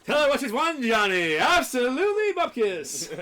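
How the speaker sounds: big booming game show host; game show announcer. Pretend.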